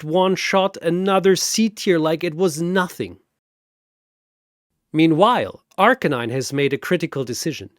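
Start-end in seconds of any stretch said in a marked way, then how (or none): none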